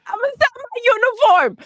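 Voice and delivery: crying teammate. Acted. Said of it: "crying, crying".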